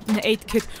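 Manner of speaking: ordinary talk, nothing marked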